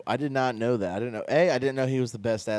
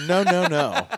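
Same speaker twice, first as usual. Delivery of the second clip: laughing; 11 semitones higher, 7 dB louder than usual. Real, not acted. Laughing protest at a joke.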